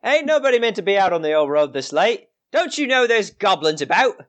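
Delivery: high-pitched scolding gatekeeper; gatekeeper calling out. Acted. Gatekeeper warning travellers about goblins, high scolding call. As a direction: holler a high warning in character. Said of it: "gatekeeper is gonna call out to you".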